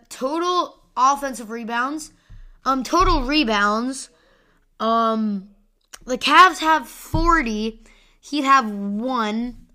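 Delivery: ordinary talk, nothing marked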